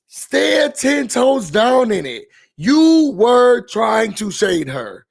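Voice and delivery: slow, deliberate, every word leaned on; emphatic call-out. Real, not acted. To her co-host about the shade, slow and pointed. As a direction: spell it out slowly so she hears it.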